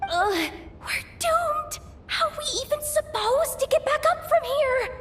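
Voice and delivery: high-pitched, whining panic; panicked despair. Acted. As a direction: wail that all is lost. Despairs of escape underground.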